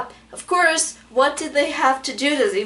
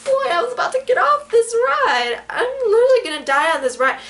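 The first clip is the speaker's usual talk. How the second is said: panicked protest; 10 semitones higher, 3 dB louder than usual. Retold or imitated. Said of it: "I'm like".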